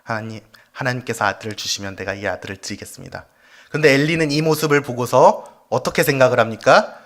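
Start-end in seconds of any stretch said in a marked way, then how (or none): 0.0-3.3 low, soft recited prayer; murmured prayer. Acted. Hannah praying before God for a son, murmured low. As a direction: pray it under your breath, low and even. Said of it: "읊조리면서 기도를 하고 있었습니다".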